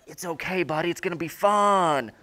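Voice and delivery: hammed-up stage whisper into someone's ear; burly instructor's mock whisper. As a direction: play the instructor hammily reassuring a nervous jumper. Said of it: "is whispering in your ear".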